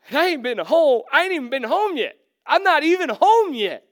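prodigal's amazed disbelief. Retold or imitated. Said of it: "You're like".

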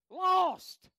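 one loud high anguished cry; retold scream. Grandfather on his deathbed screaming his fate, loud and high. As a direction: wail it out as a dying man. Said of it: "And he died screaming".

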